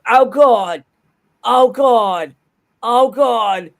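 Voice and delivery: loud, high-pitched cartoon wailing; hammed-up nauseated moaning; Family Guy character. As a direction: cry out in queasy cartoon horror.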